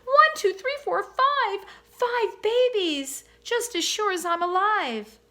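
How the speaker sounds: high, loud, slow delighted counting; swan counting babies. A narrator's reading. Swan counting her five babies, high and delighted. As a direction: count each baby with wonder.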